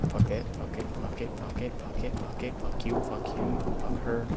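soft, half-voiced repeated swearing; muttered cursing. Real, not acted. Player pushing on through danger, muttered cursing. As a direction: chant curses under your breath. Muttered panicked chant of curses.

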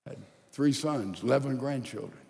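normal talking voice

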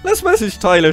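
usual speaking voice

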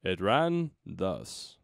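slow, drawn-out storyteller's line; stately, deliberate reading; grand narration. Acted. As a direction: stretch each word with a pause before the last. Reads the line theatrically.

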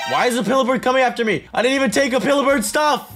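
fast, high-pitched alarm; panicked protest. Real, not acted. Player wrongly chased by a monster, panicked protest.

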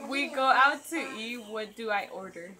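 normal talking voice

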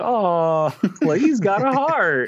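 high-pitched cooing through laughter; mock touched, laughing. Nephew reacting to his tough uncle admitting tears, laughing mock gush.

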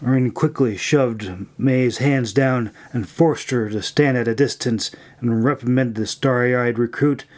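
usual speaking voice

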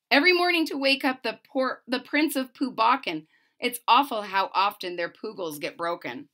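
lively, bouncy read-aloud of rhymes; storybook narration. A narrator's reading. Reading a rhyming book to a child, lively narration.

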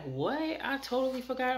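surprised. Retold or imitated. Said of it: "I'm like".

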